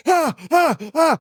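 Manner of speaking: sudden high startled cries, woken with a jolt; startled yelps. Acted. Sleeper shaken awake cries out in high alarm. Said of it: "grabs your arm and goes".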